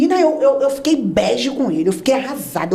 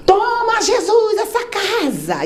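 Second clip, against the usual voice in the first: high, drawn-out pastor call; preacher calling out; 9 semitones higher, much slower than usual. Retold or imitated. The missionary claiming the house for Jesus, high and drawn out.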